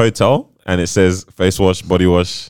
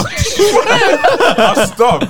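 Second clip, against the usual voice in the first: laughing; 4 dB louder, much slower than usual. Real, not acted. Laughing confession about face washing.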